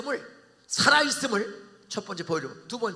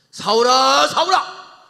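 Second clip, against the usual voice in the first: loud, high calling out a name; booming call; 6 semitones higher, 16 dB louder, slower than usual. Acted. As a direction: call down from heaven. Voice from heaven calling Saul.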